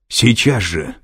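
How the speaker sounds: loud, urgent command; yelling. A narrator's reading. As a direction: press the order home forcefully. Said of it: "заорал Лэнгдон".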